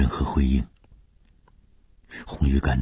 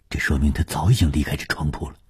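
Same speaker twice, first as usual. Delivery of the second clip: usual speaking voice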